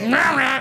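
high, garbled imitation of angry noises; mimicked inarticulate protest; mock angry grumbling. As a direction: yammer wordlessly as the mad man. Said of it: "And he was like".

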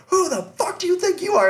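very high-pitched mock outrage; indignant guard. Security guard confronts the fan, retold indignant outburst. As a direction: imitate an affronted guard squawking a challenge.

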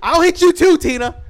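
high, loud threatening shout; jokey loud threat to the mom; mock threat yell. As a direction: yell at Tina, hammed up. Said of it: "You're like".

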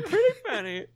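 imitating a masked villain's voice; Bane voice. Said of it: "You sounded like Bane".

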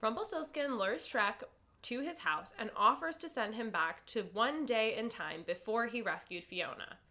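ordinary talk

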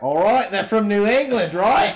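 loud, high, hammed-up Australian accent; bad Australian accent, shouted for laughs; mock Australian accent. Mocking Yankees in a fake Australian accent. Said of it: "the Australian accent for the New England".

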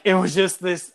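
normal talking voice

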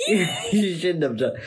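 high, slow laughing reproach; laughing scold. Real, not acted. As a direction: scold through giggles. Laughing disapproval.

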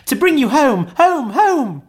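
put-on very high, loud child's delight; gleeful little girl. A narrator's reading. Little sister brings her brother news, overjoyed. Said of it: "clapping her tiny hands".

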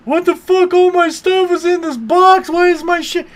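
high, loud outraged shout; furious yelling. Retold or imitated. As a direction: scream at the thief who took his stuff. Friend finds his stolen loot.

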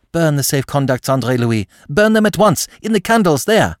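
put-on young woman ordering André Louis, sharp and urgent; sharp command. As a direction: snap out the way out of checkmate. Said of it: "spoke sharply".

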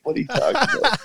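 high, laughing what are you talking about; laughing disbelief. Retold or imitated. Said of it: "And I'm like".

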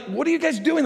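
high incredulous question; angels asking. Retold or imitated. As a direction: quiz the gazing disciples. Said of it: "And they're like".

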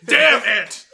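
loud laughing damn it; mock exasperated shout. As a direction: yell it in fake frustration. Echoing friend's quote.

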